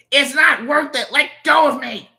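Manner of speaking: high, loud struggling protest; frantic protest. Acted. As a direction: yell to be released. Cousin struggles to flee.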